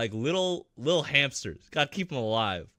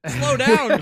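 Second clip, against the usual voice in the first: louder high call; high raised shout; 10 semitones higher, 10 dB louder than usual. Real, not acted. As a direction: call out to slow him. Calling out.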